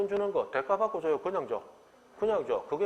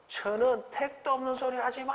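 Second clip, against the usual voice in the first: put-on indignant child protest; 9 semitones higher than usual. Child scoffing at a thousand-won allowance, mock indignation.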